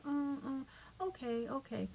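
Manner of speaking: put-on a small girl giving in nervously; hesitant little girl.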